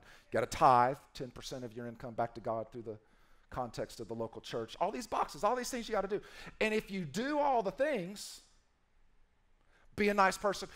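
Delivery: ordinary talk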